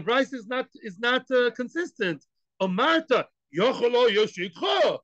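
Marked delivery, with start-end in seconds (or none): 2.6-5.0 Gemara's challenging tone. Shown as a demonstration. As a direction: call it out like a sharp challenge. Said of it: "Whenever the Gemara uses that tone of voice".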